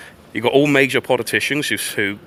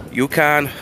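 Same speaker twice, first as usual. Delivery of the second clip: higher-pitched attempt at a Russian accent; bad Russian accent; 4 semitones higher than usual. Playing Russians addressing Western powers, bad Russian accent. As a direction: try a Russian accent, badly. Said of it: "I can't do a Russian accent".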